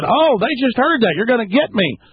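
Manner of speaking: mocking high-pitched gotcha. Mocking him in front of witnesses.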